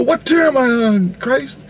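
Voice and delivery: mock scream to the heavens; retold scream. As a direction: call out high, then fade. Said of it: "all of a sudden I scream".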